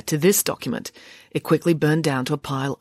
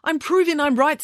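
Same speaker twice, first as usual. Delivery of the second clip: defiant retort; 12 semitones higher than usual. A narrator's reading. Calls back over headmaster's yell.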